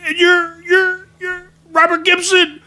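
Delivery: high, loud imitation of an amazed fan; starstruck disbelief. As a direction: stammer it out in overexcited fan shouts. Starstruck dealer recognizes second wrestler.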